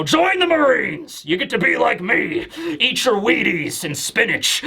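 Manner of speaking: mock recruiting pitch, starting high; recruitment-poster hero. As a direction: play a legendary hero selling the service. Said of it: "like, a recruitment tool for the Marines".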